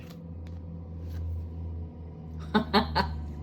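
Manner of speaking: long breathy laugh at the cards; amused laugh. Real, not acted. Reader laughing to viewers as the card appears. Card matches what she just said.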